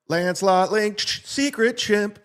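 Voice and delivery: announcer title. Acted.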